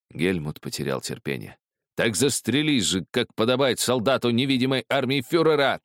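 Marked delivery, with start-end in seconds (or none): 1.9-5.8 high, exasperated outburst; impatient cry. A narrator's reading. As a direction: cry out, out of patience. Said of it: "вскрикнул он". (раздражённый высокий выкрик)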